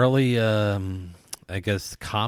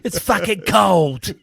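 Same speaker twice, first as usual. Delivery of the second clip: slow, high, loud mock chef yell; hammed-up kitchen tirade; Gordon Ramsay; 8 semitones higher, 5 dB louder, slower than usual. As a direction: stretch out the outrage. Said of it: "Gordon Ramsay would just be like".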